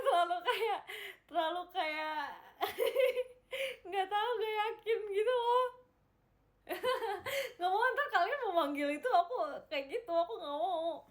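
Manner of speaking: laughing embarrassed protest. Real, not acted. Embarrassed over a rapper name.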